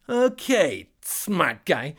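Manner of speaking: high, loud scoffing genie. Acted. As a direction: sneer like a cocky genie. Genie voiced in story.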